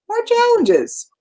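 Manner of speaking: high, slow, put-on voice for the punchline. Shown as a demonstration. Answering her own question to the listener, high and slow. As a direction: lift it way up and stretch it out. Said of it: "guess what the universe is gonna give me".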